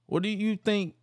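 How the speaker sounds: high-pitched mock question; taunting. Bradshaw continues to taunt Regal, teasing. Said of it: "they continue to taunt him".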